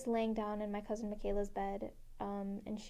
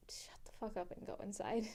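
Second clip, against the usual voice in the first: whispered command; 6 dB quieter than usual. Retold or imitated. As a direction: hiss it so no one hears. Dad firmly whispering to mom.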